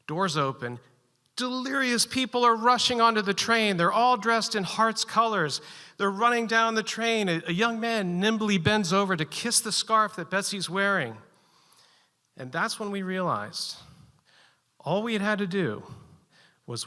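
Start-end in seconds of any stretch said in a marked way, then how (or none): 1.4-11.2 high, loud, breathless excitement; excited. Retold or imitated. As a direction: swept up in the rush of fans. Fans storm the train, climax.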